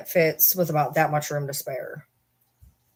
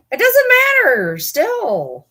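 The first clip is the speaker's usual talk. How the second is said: high exclaim; 12 semitones higher, 7 dB louder, much slower than usual. Real, not acted. Exclaiming at the donation amount.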